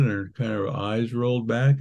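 usual speaking voice

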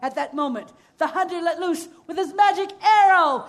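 ordinary delivery, nothing marked

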